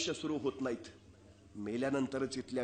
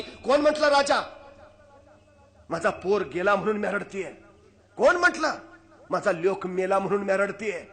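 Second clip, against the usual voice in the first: fierce mother; 4 semitones higher, 7 dB louder than usual. Acted. Mother retorts sharply.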